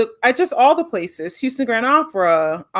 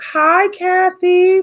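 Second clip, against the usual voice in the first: calling out hi; 9 semitones higher, 4 dB louder, much slower than usual. Real, not acted. Greeting a viewer in chat.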